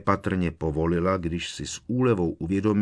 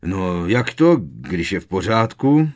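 loud, irate challenge; angry uncle demanding an answer loudly; barked; 8 dB louder than usual. A narrator's reading. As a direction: bark the question. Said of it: "vyštěkl strýc Vernon".

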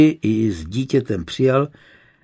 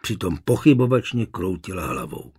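usual speaking voice

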